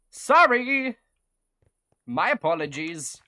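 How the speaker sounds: pompous Victorian inventor, slow on the last line; 1800s robber baron voice. Acted. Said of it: "talking like a eighteen hundreds".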